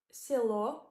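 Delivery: selo as siLO, stress on the final o; teacher demo of selo; Russian word selo. Shown as a demonstration. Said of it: "The O is stressed".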